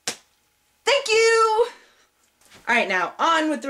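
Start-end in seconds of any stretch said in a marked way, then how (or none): 0.1-1.7 high, drawn-out squeal of thanks; super excited. Real, not acted. Vlogger thanking new subscribers, super excited. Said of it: "I'm super excited".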